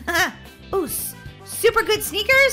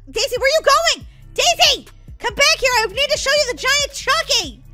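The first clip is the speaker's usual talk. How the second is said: high, raised call to a friend; urgent calling after; 9 semitones higher, 5 dB louder than usual. Acted. Molly to departing Daisy, urgent calling after. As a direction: call her back urgently.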